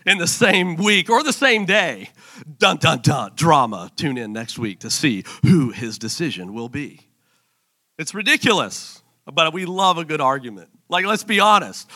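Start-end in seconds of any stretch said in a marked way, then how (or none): 2.6-7.0 lightly voiced low promo announcer. Announcer teasing next week's show, mock-dramatic promo voice. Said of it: "the promo of the show is".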